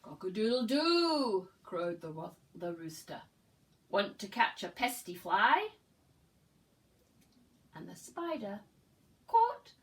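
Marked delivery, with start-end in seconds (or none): 0.0-1.5 loud crowing "Cock-a-doodle-doo"; big rooster crow. Acted. Rooster crows in picture book. 3.9-5.7 louder, bold question; the rooster calls to the spider, loud and bold; loud rooster invitation. Acted. 9.3-9.7 much higher and louder "caught"; sudden excited burst; excited snap. Acted. Climax of story, spider catches fly.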